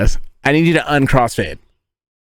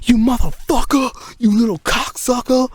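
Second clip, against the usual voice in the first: much higher, shrill-pitched mock mom tirade; hammed-up screeching mother cursing out her kid; furious mom; 13 semitones higher than usual. Mom scolds son over flooded toilet, hammed-up high screech. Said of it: "my mom was just like".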